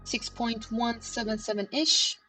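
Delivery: usual speaking voice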